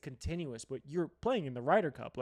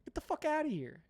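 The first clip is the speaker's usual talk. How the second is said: much higher, incredulous brush-off; high-pitched dismissal; 11 semitones higher, 3 dB quieter than usual. Real, not acted. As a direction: wave the critics away in a high voice. Mock dismissal of online critics.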